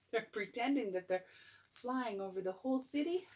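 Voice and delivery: ordinary delivery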